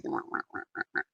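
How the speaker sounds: whispered mock whining; soft sarcastic wah wah; whiny mock wah. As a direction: whimper like a sulky child. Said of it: "And I was like".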